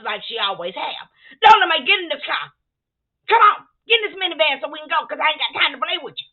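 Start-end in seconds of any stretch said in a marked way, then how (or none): 1.4-6.3 loud, impatient command; loud scolding at Lola Mae; bossy order. Retold or imitated. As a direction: hurry her into the van.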